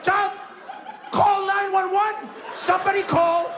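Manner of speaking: high, urgent mock yell; hammed-up call for help. Slapped pupil begging classmates for help, mock high cry.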